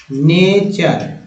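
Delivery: "नेचर" slowly in two syllables, "nay-char"; "char" ending. Shown as a demonstration. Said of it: "टी यू आर ई को चर पढ़ते". ("नेचर" धीरे, अंत में चर की आवाज़)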